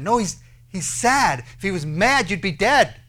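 loud, high and insistent; emphatic correction. Real, not acted. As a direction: punch out the rebuttal. Corrects the people's mistaken idea.

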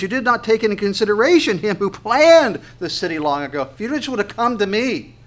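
high, loud, emphatic sermon delivery; impassioned preaching. Acted. Preaches the verse at full force.

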